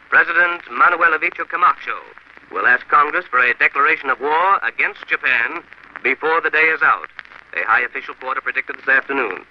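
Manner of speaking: crisp 1940s radio bulletin read. Real, not acted. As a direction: announce the war bulletins like vintage radio.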